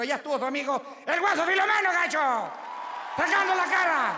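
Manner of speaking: loud, high proud boast to the crowd; proud shouting. Acted. Comedian as guaso shouts his pride to the audience.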